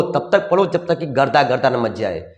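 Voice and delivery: ordinary talk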